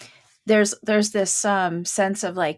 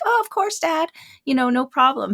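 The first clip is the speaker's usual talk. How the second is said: agreeable daughter; 7 semitones higher than usual. Retold or imitated. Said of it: "And they're like".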